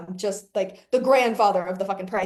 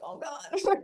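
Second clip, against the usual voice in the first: nervous gasp; 6 semitones higher than usual. Retold or imitated. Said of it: "you're like".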